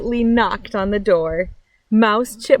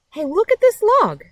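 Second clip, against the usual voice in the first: much higher and louder, delighted; excited discovery; 11 semitones higher, 3 dB louder than usual. Acted. Friend calling the other to a new log, excited. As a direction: shout about the log with joy.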